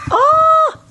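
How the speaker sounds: very high thrilled shriek; a sudden squeal of delight; excited squeal. Real, not acted. Vlogger spotting the meme, squealing with excitement.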